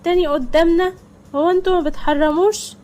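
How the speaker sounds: put-on high, loud child's voice telling dad off; kids scolding.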